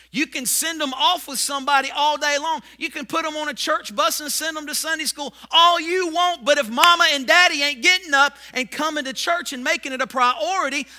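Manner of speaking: loud, rising pulpit appeal; full-force preaching. Acted. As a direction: hammer it home to the parents.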